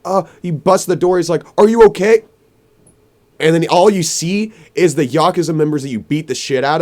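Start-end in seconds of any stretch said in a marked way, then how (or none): 1.6-2.2 high, loud worried shout; urgent call. Retold or imitated. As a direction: burst in yelling it. Dad bursting through door.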